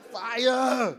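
a quick high Austrian Arnold tag; Arnold Schwarzenegger accent. Retold or imitated. Comedian ends the Arnold bit with Austrian accent. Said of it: "hear him say".

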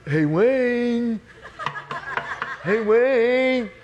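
high, drawn-out singsong call; Mike. Retold or imitated. Roommate calling sleeper through door, high pestering call. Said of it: "then I hear this voice".

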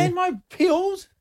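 slow, high-pitched little plea; small, high, needy voice; childlike checking. Pretend. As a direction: ask like a child needing reassurance. Pleading list, echoed by soothing partner.

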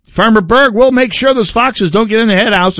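high, eager, fawning fox voice; sly fox. Retold or imitated. Fox assuring the farmer it will guard the hens, high and fawning. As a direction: play the sly fox, all sweet promises. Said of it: "And the fox said".

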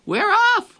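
high, loud cheer; crew shout. A narrator's reading. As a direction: cheer it out like sailors. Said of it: "we all shouted".

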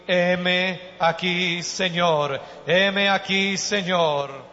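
loud, drawn-out devotional cry; fervent declaration. Real, not acted. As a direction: proclaim it to God with full voice. Fervent declaration of surrender to God. (declaración ferviente a Dios, en voz fuerte)

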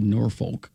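Norfolk with a clear R and full "folk"; Norfolk as written. Retold or imitated. Host reads a listener's correction, Norfolk said NOR-foke. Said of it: "We do not say Norfolk".

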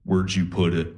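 a creepy trance voice, low and even; imitating girlfriend sleep talking low; eerie sleep voice. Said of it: "she just turns to me and goes".

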